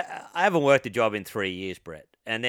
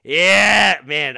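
proud yell; 6 semitones higher, 15 dB louder than usual. Retold or imitated. Proud reply to job offer.

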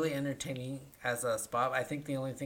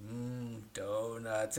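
robot voice; 3 semitones lower, 5 dB quieter, much slower than usual. Retold or imitated. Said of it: "in a robot voice".

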